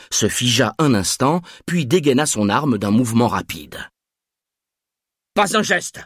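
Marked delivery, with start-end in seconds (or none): 5.3-6.0 high, sharp shouted command; shouted order. A narrator's reading. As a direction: bark the order at the gunman. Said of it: "cria-t-il".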